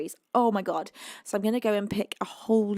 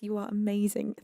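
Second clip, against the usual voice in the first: usual speaking voice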